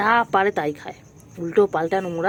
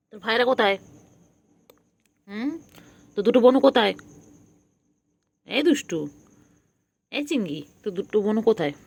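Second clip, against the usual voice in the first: ordinary talk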